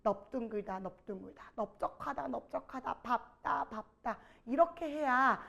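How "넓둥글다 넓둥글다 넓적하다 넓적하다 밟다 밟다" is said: 넓둥글다, 넓적하다 with "neop", 밟다 as "bap-tta", murmured; repetition drill. Shown as a demonstration. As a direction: softly repeat each word twice, like memorizing. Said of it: "입에 붙이시고 귀에 자꾸 들으세요".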